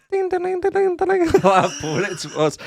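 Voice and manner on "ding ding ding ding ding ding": high ding sound. Retold or imitated. Mimicking the film's eerie chime, high. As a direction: sing high dings like a music box.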